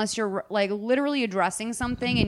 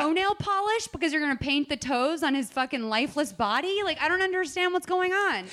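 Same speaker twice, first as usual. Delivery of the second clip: higher-pitched incredulous rant; exasperated disbelief; 6 semitones higher than usual. Retold or imitated. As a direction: build a comic protest of bafflement. Mock outraged reaction to the scene.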